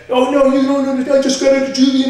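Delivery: high, loud imitation of frantic begging; pleading burglar. Burglar begs homeowner not to call cops, mock pleading.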